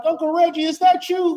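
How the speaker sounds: squeaky high, startled and unsure; high startled question. Retold or imitated. Friend startled by the spirit channel, high unsure question. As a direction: ask it in a thin high voice, half scared. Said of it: "You'd be like".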